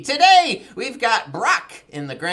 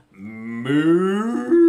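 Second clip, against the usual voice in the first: long rising moo; cow moo; 5 semitones lower, 4 dB louder than usual. Pretend. Host plays the cow in farm song, mooing. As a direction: draw out a cow's moo. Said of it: "he makes a sound like this".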